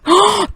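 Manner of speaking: sharp, high excited gasp; loud gasp. Real, not acted. Spotting the old photo, a sharp excited gasp.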